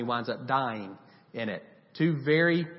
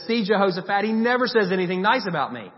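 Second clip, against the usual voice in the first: whiny complaint; 6 semitones higher, 6 dB louder than usual. Retold or imitated. Ahab complaining to Jehoshaphat.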